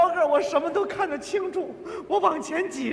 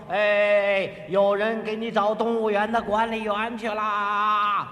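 lower, slow reassuring voice; slow it down, a second onlooker; another bystander; 7 semitones lower, slower than usual. Retold or imitated. Second bystander tells the trapped man, lower and slow. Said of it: "那个说".

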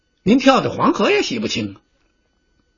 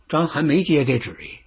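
plain reading voice

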